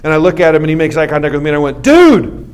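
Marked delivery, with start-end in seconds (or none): 1.8-2.5 loud, high, stretched-out cheer; hammed-up amazed shout; acted hype. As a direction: whoop it like the best stunt ever. Acts cheering on crashed boy.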